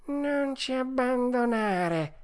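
high, slow tearful wail; whining fox. A narrator's reading. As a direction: plead tearfully not to be left. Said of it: "con voce di piagnisteo". (piagnisteo acuto, lento, quasi in lacrime)

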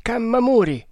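high urgent call; exclaiming. A narrator's reading. Agur exclaims to Kammamuri, urgent high call. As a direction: snap his name out sharply. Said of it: "esclamò Agur".

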